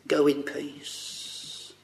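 slow, hushed blessing; slow whisper. Acted. The Lord speaking to his people.

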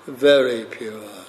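very pushed out firmly, then pure falling away; stress on very. Shown as a demonstration. Preacher to congregation, emphasis on very. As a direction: lean on the word very for emphasis. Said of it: "For emphasis".

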